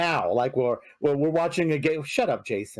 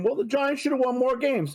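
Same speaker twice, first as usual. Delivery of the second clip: mocking critics; 8 semitones higher than usual. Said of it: "a lot of people are saying".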